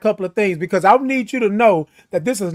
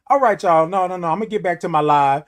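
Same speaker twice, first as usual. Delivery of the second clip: ordinary delivery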